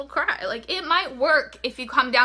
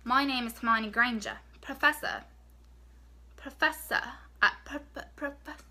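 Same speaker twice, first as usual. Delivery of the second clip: halting, badly faked English accent; Hermione Granger; 5 dB quieter than usual. Shown as a demonstration. Vlogger practising as a Harry Potter pupil, poor English accent. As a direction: try out an English accent, word by word. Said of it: "don't say it in an English accent".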